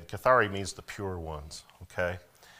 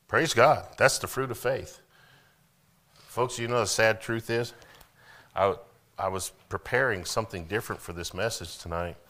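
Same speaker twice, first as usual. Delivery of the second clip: ordinary talk, nothing marked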